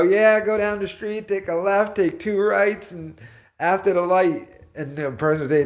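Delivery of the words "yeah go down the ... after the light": higher, breezy know-it-all voice; confident fake directions. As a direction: rattle off directions like you're sure. Imitates the liar giving directions.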